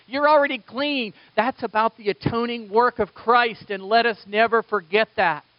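high, loud, fervent preaching; preaching at full force. Acted. Pastor urging the congregation, fervent loud preaching. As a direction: drive the gospel home.

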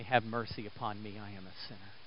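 very soft, low penitent prayer; barely voiced sinner's plea for mercy; humble plea. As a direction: sink to a hushed confession. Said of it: "and say".

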